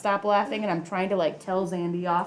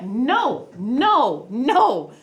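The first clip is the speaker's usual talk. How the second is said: louder, rising no no no; mock alarmed objection; retold protest; 5 semitones higher, 5 dB louder, much slower than usual. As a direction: cut them off fast, pleading. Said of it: "and I'm like".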